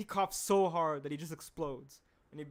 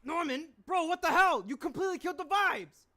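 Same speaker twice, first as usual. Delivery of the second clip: much higher and louder, outraged shriek; shocked cartoon yell; 13 semitones higher, 9 dB louder than usual. Shown as a demonstration. Voice actor demos a character yelling at Norman. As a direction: scream it like a cartoon character losing it. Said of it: "Oh, wait, whoops".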